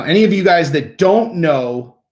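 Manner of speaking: ordinary talk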